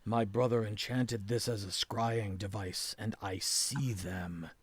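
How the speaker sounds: low, hushed character voice; subdued, absorbed seer. Acted. Game master voices Viraz explaining his vision.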